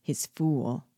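a touch of hoarse menace; understated hoarse dwarf voice. A narrator's reading. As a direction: say it with mild hoarseness. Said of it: "said hoarsely".

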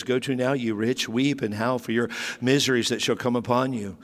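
low, measured reading of scripture; preacher reading the Bible aloud to the church. Acted. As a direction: read the verse out with weight. Reads James chapter five.

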